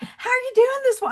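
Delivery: bubbly small talk at the counter; chirpy greeting. Retold or imitated. Georgia visitor chats up croissant server, high and cheery. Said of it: "and I was like".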